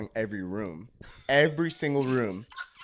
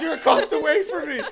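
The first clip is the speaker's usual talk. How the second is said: much higher, laughing through it; laughing disbelief; 6 dB louder than usual. Real, not acted. Telling the friends about the discovery, laughing.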